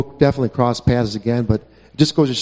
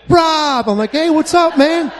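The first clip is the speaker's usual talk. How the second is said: high happy shout of greeting; excited greeting; 4 dB louder, slower than usual. Retold or imitated. Two old friends reunite in a parking lot, excited high shout.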